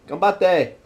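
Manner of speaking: high-pitched "ganbatte"; encouraging. Shown as a demonstration. Urging someone to keep fighting, high encouraging "ganbatte". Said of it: "say it in so many ways".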